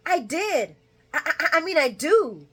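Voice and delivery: high, stammering correction; flustered Lucy. Retold or imitated. Lucy correcting herself to Martin, high and stammering. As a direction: trip over your own words.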